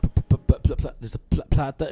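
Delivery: mock stutter. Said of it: "It's like".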